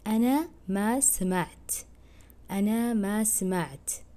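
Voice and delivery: slow أنا ما سمعت, sa-mi-ʿt said in full; teacher modelling أنا ما سمعت slowly; careful Arabic. Shown as a demonstration. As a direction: say أنا ما سمعت slowly, every sound of سمعت clear. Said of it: "খেয়াল করেন আমি কীভাবে উচ্চারণ করছি".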